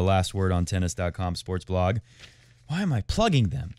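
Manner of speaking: ordinary delivery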